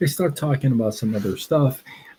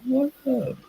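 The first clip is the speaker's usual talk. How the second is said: squeaky high hello; playful cartoon-high what up; falsetto greeting; 3 dB quieter than usual. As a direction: jump up into a silly falsetto for the viewer. Greeting chat viewer in falsetto.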